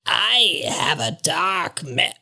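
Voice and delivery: breathy, slow whispered raven voice; raspy raven whisper. Acted. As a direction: hiss it like a croaking bird.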